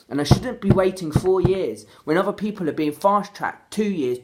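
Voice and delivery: ordinary talk, nothing marked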